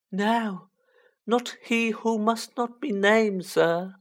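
high, drawn-out elf denial; small creature's deliberate high voice; Dobby, hinting house-elf. Acted. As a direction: answer high and slow, with care.

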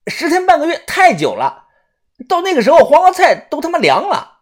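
high-pitched, exasperated outburst; loud protest. A narrator's reading. Young digger objecting to the long wait, loud protest. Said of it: "他大声地说".

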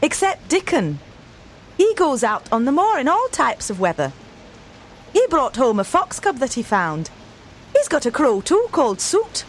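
a proud sister bragging cheerfully; maid Martha. A narrator's reading. Narrator holds Martha's voice.

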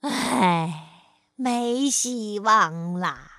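low, slow sigh of despair; slow, low, defeated sigh. Acted. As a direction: sigh it out hopelessly. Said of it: "唉声叹气地说".

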